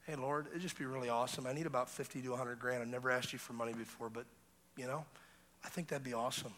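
low, soft, casual ask; nonchalant prayer. Retold or imitated. Preacher retelling his prayer to God, low and casual. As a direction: toss off the request like chatting with dad. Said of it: "I said very nonchalantly in my prayer".

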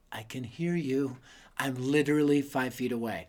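put-on a kid's quiet sulky reply from five feet away; under-breath retort. Kid answering uncle under breath.

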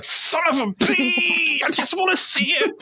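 high exasperated mock wail; mock frustrated squeak. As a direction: squeal like you're fed up. Own frustration quoted.

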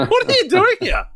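high startled question; surprised question. Retold or imitated. Neighbour asking Sarah why she's there, high and incredulous. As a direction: act out his disbelief at finding her.

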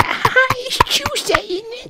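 squealing falsetto British caricature; absurdly high-pitched British impression; mock British accent. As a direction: mimic a Brit in a very high, stretched-out squeal. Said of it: "You know what you be like".

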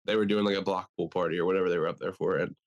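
ordinary delivery

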